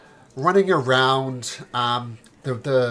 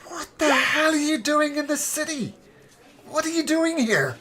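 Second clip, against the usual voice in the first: surprised character voice. Acted. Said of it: "follows him in and says".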